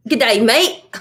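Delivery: put-on a laughing, half-hearted Australian accent; bad Australian accent. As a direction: try an Australian greeting and fail. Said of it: "I can't speak in an Australian accent".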